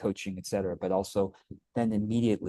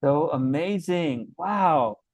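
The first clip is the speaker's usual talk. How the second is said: high, drawn-out delight; wowed and thrilled; amazed; 6 semitones higher, 4 dB louder, much slower than usual. Real, not acted. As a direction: marvel warmly at the story. Host marvels at the client's story.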